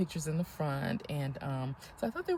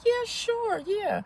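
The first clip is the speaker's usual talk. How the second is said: high-pitched imitation of young performers agreeing; agreeable girls' reply; 3 dB louder, much slower than usual. Girls agreeing to a fan's picture request, high imitated reply. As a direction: mimic an eager yes in a high voice.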